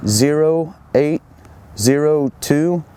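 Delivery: slow, clipped radio call-out; radio voice procedure. Shown as a demonstration. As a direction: read the report like a radio operator. Sends spot report over radio.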